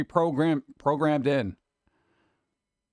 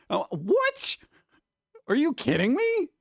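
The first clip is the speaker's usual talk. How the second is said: squeaky, very high incredulity; hammed-up disbelief leaping into a high squeal; mock outrage; 10 semitones higher, slower than usual. User reacting to an offended assistant, mock outrage.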